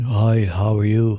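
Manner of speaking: low, slow put-on greeting; demure Mary voice. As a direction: introduce yourself low and slowly, in character. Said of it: "I'm Mary".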